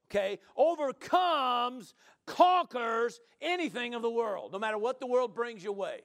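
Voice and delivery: fervent preaching. Real, not acted. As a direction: drive it home to the congregation. Preaches at full force.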